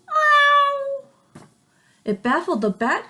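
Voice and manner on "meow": high loud yowling meow; hissing cat. Acted. Cat woken by the sneeze, loud high meow. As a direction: screech the meow like an angry cat. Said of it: "who hissed".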